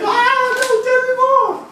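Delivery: very high squealed outburst; mock over-excitement. Reacting to brother's non-committal answer, mock excited squeal. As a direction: squeal it, hugely overdone. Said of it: "Like that".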